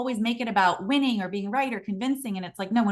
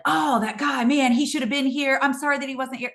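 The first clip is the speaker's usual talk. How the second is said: raised, put-on exasperation; mock grumbling; 4 semitones higher, 3 dB louder than usual. Manager blaming his absent sales guy, mock exasperated. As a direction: play up the blame, higher at the start. Acts out the wrong, blaming response.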